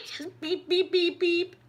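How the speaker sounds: high, sing-song beep beep like a horn; mock car horn beeps. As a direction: toot it out like a cheeky car horn. Girls honking at [name].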